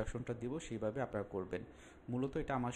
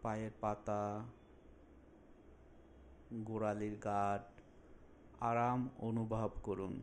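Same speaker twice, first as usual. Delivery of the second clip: slow, drawn-out phrases with long pauses; unhurried relaxation instructor, lingering on soothing words; guided meditation. Acted. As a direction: guide them calmly through a body scan. Leads a guided body relaxation.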